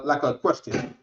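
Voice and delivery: usual speaking voice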